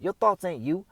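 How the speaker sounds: ordinary delivery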